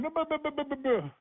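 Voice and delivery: high nonsense babbling to mock the caller's talk; mocking gibberish babble. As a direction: babble buh-buh sounds in a high voice to mock him. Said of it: "That's how you sound right now".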